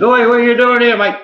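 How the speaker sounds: high and loud mock challenge of Oi, mate; gruff local bloke. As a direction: play a bloke calling out to a stranger. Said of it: "Some bloke said".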